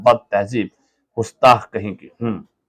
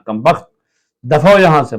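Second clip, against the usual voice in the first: louder, higher dismissal; angry dismissal; 5 semitones higher, 6 dB louder than usual. Acted. Sick husband ordering the sweeping servant out, angrily. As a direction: drive the servant out.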